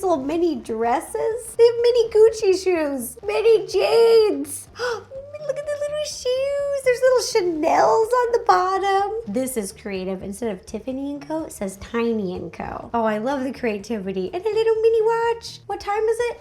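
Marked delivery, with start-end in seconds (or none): 1.6-4.8 high, louder, slow cooing over each item; excited gushing. Real, not acted. Gushing to viewers over mini designer clothes, high excited coo. 5.5-9.1 excited gushing. Real, not acted. Gushing to viewers over mini designer clothes, high excited coo. Gasping at tiny shoes. 14.3-16.4 cute cooing. Real, not acted. Showing viewers a tiny watch, high cooing voice.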